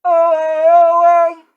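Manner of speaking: very high, very loud answering yell; mock yell answering the jock; shouting back. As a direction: yell it back like the smaller kid. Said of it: "shop class kids going".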